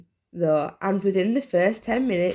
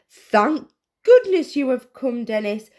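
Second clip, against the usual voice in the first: higher, louder eager mother; proud mum voice; 6 semitones higher, 6 dB louder than usual. A narrator's reading.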